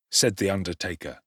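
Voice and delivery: usual speaking voice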